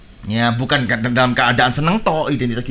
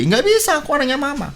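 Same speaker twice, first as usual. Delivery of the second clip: much higher, retold childish protest; defiant insistence; 10 semitones higher than usual. Boy tells everyone he is mama's child, defiantly insistent. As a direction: imitate a kid standing his ground, pitched right up.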